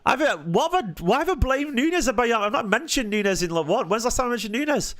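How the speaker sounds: high, baffled pitch climbing in disbelief; incredulous protest. Real, not acted. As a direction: protest an accusation you find absurd, pitched high. Accused of blaming Nunes, protests.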